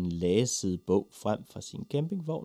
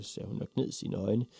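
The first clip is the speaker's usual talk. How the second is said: ordinary delivery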